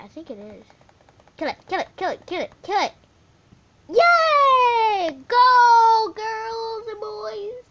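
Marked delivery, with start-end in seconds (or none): 1.4-2.9 louder, rising chant of kill it; shouting. Real, not acted. Kid gamer chanting at the sheep, loud and eager. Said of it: "I'm sorry for screaming". 3.9-7.7 very loud high yay, stretched slow; cheering. Real, not acted. Kid gamer cheering her viewers after a kill, very loud.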